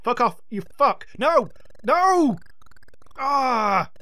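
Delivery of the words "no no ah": panicked yell. Real, not acted. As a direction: shout in desperate struggle. Struggle with enemy.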